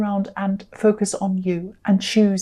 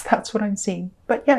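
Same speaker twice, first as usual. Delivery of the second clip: usual speaking voice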